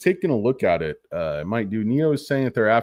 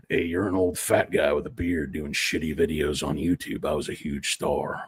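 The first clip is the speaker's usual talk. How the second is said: put-on old star voice; 6 semitones lower, 4 dB quieter than usual. Aging star mocks the speaker.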